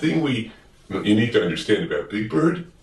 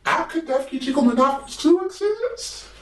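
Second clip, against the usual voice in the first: much higher, wondering bird voice; high Big Bird voice. Acted. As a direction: ask Gordon in a high, eager bird voice. Big Bird asks Gordon.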